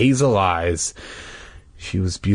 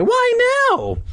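high sister voice; 3 dB louder than usual. Acted. Retake of sister's line.

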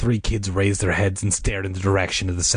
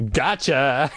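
gleeful, higher-pitched taunt; triumphant declaration; 5 semitones higher than usual. A narrator's reading. Boy revealing his prank to the girls, triumphant. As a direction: crow it like a prankster. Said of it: "he declared".

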